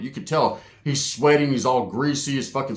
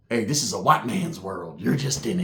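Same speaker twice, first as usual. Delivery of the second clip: low, quiet menacing mock; a hint of a quiet prison threat; Aryan tough guy; 8 semitones lower, 4 dB quieter than usual. Roach acting Aryan tough.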